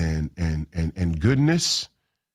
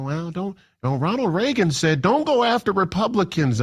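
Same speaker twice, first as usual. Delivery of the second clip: much higher, whiny boomer imitation; mock boomer; 9 semitones higher than usual. Host mocking boomers who quote Reagan, high whiny mock voice. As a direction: mimic an old boomer quoting Reagan. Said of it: "boomers I still hear quoting".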